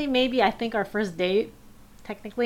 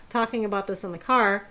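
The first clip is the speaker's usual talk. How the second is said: usual speaking voice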